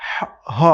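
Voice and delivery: হ breathed from the throat, unvoiced; strong Arabic ح; whispered throaty ha. Shown as a demonstration. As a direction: push air hard from the throat on হ. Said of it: "আর একটা".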